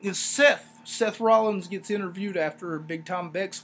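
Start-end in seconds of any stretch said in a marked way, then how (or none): none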